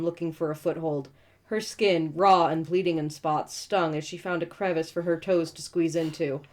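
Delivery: ordinary talk, nothing marked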